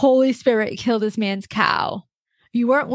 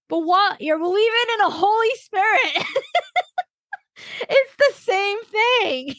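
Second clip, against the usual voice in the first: much higher pitch, breaking into laughter; laughing disbelief; 10 semitones higher than usual. Real, not acted. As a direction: protest incredulously through real laughter. Incredulous at parents' contradictory belief.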